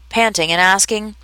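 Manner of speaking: ordinary delivery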